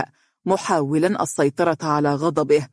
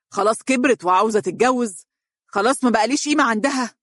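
higher, fast, wounded shouting; yelling in anger; 6 semitones higher, faster than usual. A narrator's reading. Brother raging over sister marrying without him, shouting. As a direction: rail at the room, stung and furious. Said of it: "وهو يصيح".

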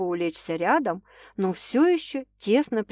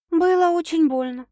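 ordinary delivery

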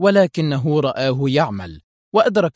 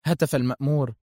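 plain reading voice

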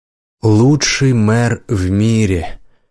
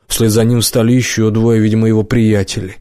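usual speaking voice

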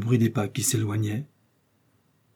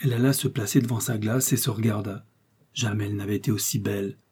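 ordinary delivery

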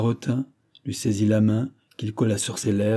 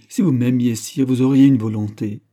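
louder, reproachful exclamation; raised voice, earnest challenge; crying out; 3 semitones higher, 7 dB louder than usual. A narrator's reading. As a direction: throw the reproach at him. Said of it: "s'écria Marie".

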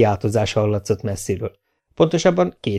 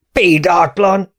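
higher, louder, slow indignant shriek; shrieking outrage; 9 semitones higher, 5 dB louder, much slower than usual. A narrator's reading. The mayor, outraged by the teacher, shrieks indignantly. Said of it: "süvített Garai".

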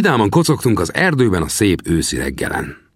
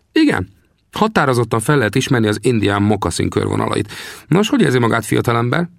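higher, teasing frontiersman; old trapper questions the young man; 5 semitones higher than usual. Acted. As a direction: play the grizzled scout, needling the greenhorn. Sam voiced again.

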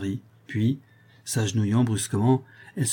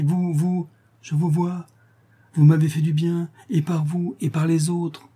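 raised pitch, swelling gratitude; mildly raised, fervent prisoner voice; fervent devoted prisoner; 6 semitones higher, 3 dB louder than usual. Acted. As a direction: adore your benefactress openly.

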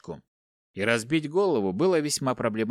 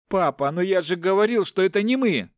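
high-pitched defensive protest; protesting son; 8 semitones higher, 4 dB louder than usual. A narrator's reading. Accused son denies it to his father, protesting.

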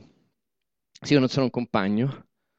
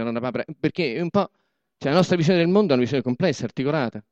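ordinary talk, nothing marked

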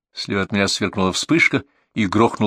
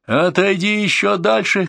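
high, drawn-out order; shouting; 10 semitones higher, slower than usual. A narrator's reading. Renard orders the journalist back, crying out high. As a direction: cry out to push him back. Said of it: "крикнул Ренард".

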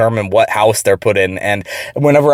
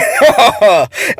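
high falling laugh; short real laugh; 8 semitones higher than usual. Real, not acted. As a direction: burst out laughing. Laughing at the quote.

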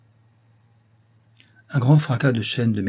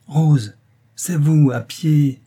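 raised, louder astonished exclamation; still stirred; 5 semitones higher, 6 dB louder, much slower than usual. A narrator's reading. Driver marvels at finding Rose on foot, still stirred. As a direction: repeat it with lingering amazement. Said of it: "encore ému".

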